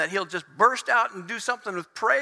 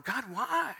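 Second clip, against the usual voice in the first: soft, raised plaintive cry; pleading, pitched-up question; questioning God; 4 semitones higher, 7 dB quieter than usual. Acted. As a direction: ask heaven in hurt bewilderment. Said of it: "you're going".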